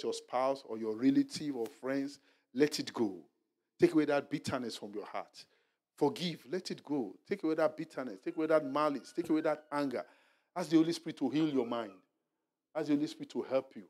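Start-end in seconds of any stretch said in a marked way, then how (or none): none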